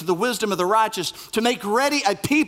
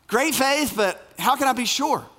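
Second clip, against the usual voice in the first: mocking doubt, higher; 6 semitones higher than usual. Shown as a demonstration. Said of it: "For a man of great faith, it seems like he has quite a bit of doubt".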